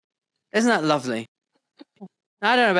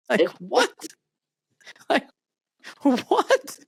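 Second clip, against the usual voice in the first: laughing disbelief; 10 semitones higher, slower than usual. Real, not acted.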